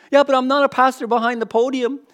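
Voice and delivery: protesting objector. Acted. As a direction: push back with a loud, high excuse. Acted objection from a hesitant listener.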